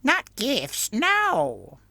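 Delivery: very high, whining voice through a mask; whining muffled voice. Acted. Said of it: "in a whining voice muffled by his mask".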